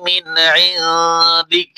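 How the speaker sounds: slow, melodic tajweed recitation; Quran recitation. Acted. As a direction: recite the full verse with tune. Recites verse slowly.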